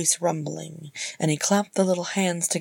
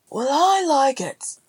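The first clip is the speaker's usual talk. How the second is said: high, drawn-out happy call; chirpy, raised-pitch shout through; cheerful call; 9 semitones higher, 4 dB louder, slower than usual. A narrator's reading. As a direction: sing out cheerily from another room. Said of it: "Beaufort called cheerfully from the kitchen".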